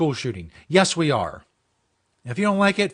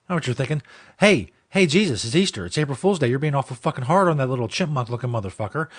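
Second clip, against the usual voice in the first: ordinary talk, nothing marked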